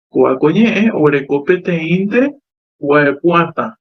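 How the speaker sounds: ordinary talk, nothing marked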